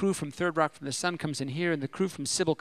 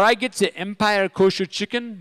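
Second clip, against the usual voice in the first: usual speaking voice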